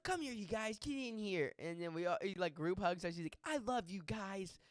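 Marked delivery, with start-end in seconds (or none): 0.0-1.5 high, eager character voice; in-character melon man. Retold or imitated. Costumed guy calling friends into a hug, character voice. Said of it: "this is how he's talking". 3.4-4.6 high, put-on character voice; in-character melon man. Costumed guy group-hugging new friends, character voice. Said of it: "this is how he's talking".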